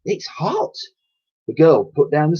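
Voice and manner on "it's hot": put-on high, loud cockney old dear; old cleaning lady voice. A narrator's reading. Old cleaner warning the girl, fussy cockney voice. Dotty scolding.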